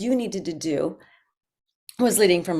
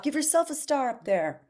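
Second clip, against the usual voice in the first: ordinary talk, nothing marked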